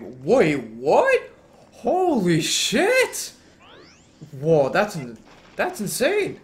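loud, high disbelief; real stunned outburst, stretched and soaring; astonished. Real, not acted. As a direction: blurt it out, rising sharply in amazement. Amazed by news of new trick.

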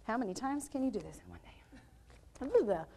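slow, soft whisper; hushed, voiceless aside. Real, not acted. Aside, unvoiced under her breath.

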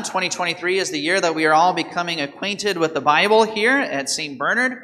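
ordinary talk, nothing marked

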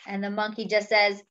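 ordinary delivery, nothing marked

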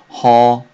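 ほ with open Korean eo, high and loud. Shown as a demonstration. Teacher asks learners to listen closely, ほ with open eo. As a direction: say ほ loudly with loose, unrounded lips. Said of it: "よく聞いてくださいね".

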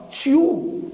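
تشيو said as a rising chu; chu sound. Shown as a demonstration. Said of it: "بتتنطق تشيو".

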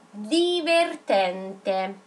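very slow, syllable by syllable; slow dictation. Shown as a demonstration. Teacher telling pupils not to rush, very slowly. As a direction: stretch the word out for writing. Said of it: "non andate di fretta".

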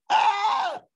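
sudden very high yelp of shock; a squeal as the penny drops; mock cry of dismay. Reaction to losing cricket and rugby.

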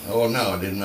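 ordinary talk, nothing marked

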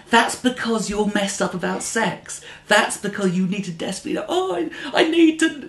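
high, exasperated comeback, lightly played; mock retort. Answering a cheap comic from the crowd, high exasperated retort. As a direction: snap back at the comic, half-joking. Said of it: "And you go".

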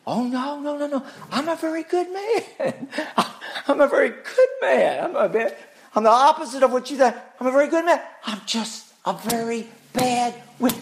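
high, lightly hammed-up wizard protesting; wizard's voice. Preacher voicing the wizard answering Dorothy, high and hammed-up. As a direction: play the wizard laughing off the charge. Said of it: "And the wizard says".

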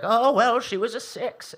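put-on old grandma voice. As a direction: play a gossipy grandma. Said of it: "That was my grandma voice".

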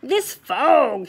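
lightly voiced Santa calling out. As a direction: call it out like Santa to his reindeer. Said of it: "he called out".

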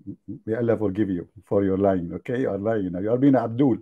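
ordinary delivery

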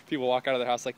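usual speaking voice

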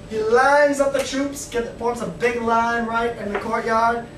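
ordinary talk

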